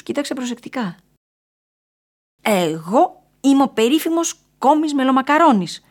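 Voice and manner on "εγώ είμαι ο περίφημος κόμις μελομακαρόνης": high, loud squealed announcement; shrill, self-important shriek. A narrator's reading. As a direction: proclaim yourself in a squeal. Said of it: "τσίριξε ο κόμις".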